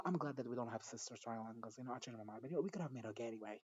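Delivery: ordinary talk, nothing marked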